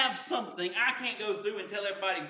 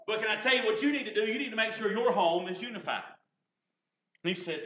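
normal talking voice

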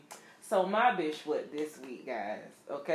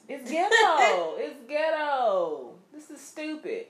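exasperated; 8 semitones higher, 6 dB louder, much slower than usual. Real, not acted. Exasperated about her weight.

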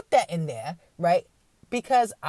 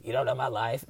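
low, soft scoffing mock quote; imitating a defensive person scoffing; dismissive brush-off; 7 semitones lower, 6 dB quieter than usual. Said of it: "people are just like".

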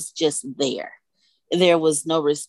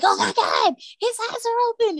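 much higher, overjoyed gasp of disbelief; baby's eyes opening, mother squeals with joy; retold excitement. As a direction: relive the moment his eyes opened, thrilled. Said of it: "And I was freaking out. I was so excited".

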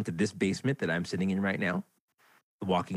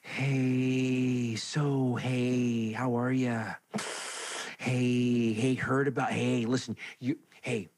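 lightly higher, overly consoling voice; mock sympathetic acquaintance; 5 semitones higher than usual. Host mimicking friends who awkwardly console him, lightly mocking. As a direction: imitate people tiptoeing around him with forced comfort. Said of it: "they walk up to you and they go".

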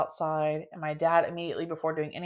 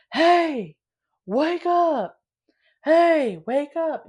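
much higher and louder, imitating a yelling cop; 11 semitones higher, 7 dB louder, much slower than usual. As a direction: bark it out like a cop waking a drunk. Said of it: "I can hear him yelling, like, really loud, deep voice".

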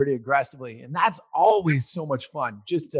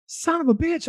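frustrated curse; 13 semitones higher than usual. Retold or imitated. Lost an hour, frustrated.

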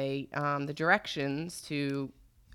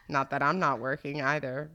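usual speaking voice

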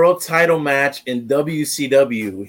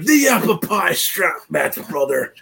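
slow, higher wrestler promo; lightly voiced Hulk Hogan; Hogan impression; 5 semitones higher than usual. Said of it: "it's like".

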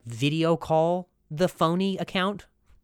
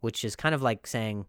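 usual speaking voice

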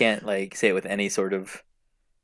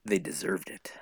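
whispered aside; 4 semitones lower, 11 dB quieter than usual. Real, not acted. Deadpan aside.

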